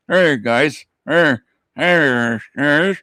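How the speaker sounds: clenched jaw greeting; tight jaw. Retold or imitated. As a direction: talk without moving the jaw. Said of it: "I'm trying to do a voice impression".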